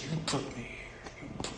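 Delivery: a hushed, voiceless hiss of blame from the damned; whispered accusation. Acted. Voicing the damned shaking fists.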